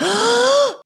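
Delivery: high, exaggerated gasp of surprise; mock gasp. Girlfriend told of a surprise, exaggerated gasp.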